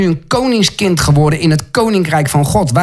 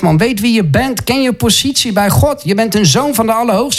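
ordinary talk, nothing marked

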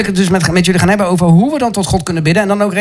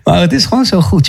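ordinary talk, nothing marked

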